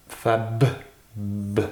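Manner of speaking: "Fab" stopped on b, then a lone "b"; consonant isolated. Shown as a demonstration. Teacher sounding out fabriquer, detached "b". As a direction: cut "Fab" at the b and repeat just that sound. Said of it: "c'est le B".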